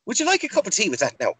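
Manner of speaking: cheery put-on Irish offer of tea; Irish accent. Shopkeeper pressing tea on the customer, in mock Irish. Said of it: "I'm talking in an Irish accent now".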